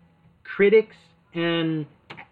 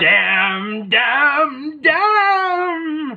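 rising wail, louder each time; grand tragic wailing; 9 semitones higher, 4 dB louder, much slower than usual. Retold or imitated. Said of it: "the ghost of Esther Roll".

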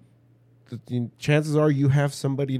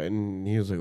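usual speaking voice

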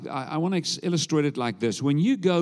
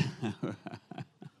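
low, quiet real chuckle; soft chuckle; 4 semitones lower, 13 dB quieter than usual. Real, not acted. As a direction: chuckle softly at your own joke. Speaker laughs after joking with congregation.

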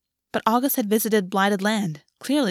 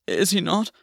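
trembling voice. A narrator's reading. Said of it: "voice trembling".

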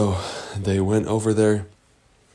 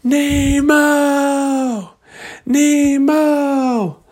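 very high, drawn-out calling yell; 4 dB louder, much slower than usual. Acted. Searchers calling for lost Nemo, long high yell. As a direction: holler the name out long and high. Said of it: "they just started yelling".

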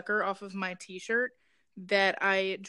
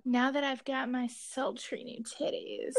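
slow, put-on sultry voice; mock sultry; 3 semitones higher than usual. Shown as a demonstration. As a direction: demonstrate a sultry voice, hammed-up. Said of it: "can't even do that with a straight face".

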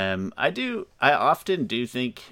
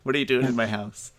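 mock shout; 3 semitones higher, 3 dB louder than usual. Mock shooing away Shyamalan.

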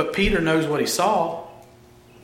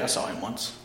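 whispered boast; 8 semitones lower, 14 dB quieter than usual. Retold or imitated. Disciple bragging, whispered.

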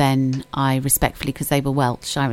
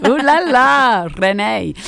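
high, slow, louder "Ooh la la, Renee"; giggly, higher and louder; playful French flourish; 6 semitones higher, 4 dB louder, slower than usual. Real, not acted. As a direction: ham up the French name with delight. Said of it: "My French name was Renee".

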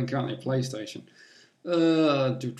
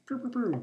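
high playful gunshot sounds; pew pew gun noises; 11 semitones higher, 4 dB quieter than usual. Pretend. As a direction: fire off toy gun pews. Mimics shooter gunfire.

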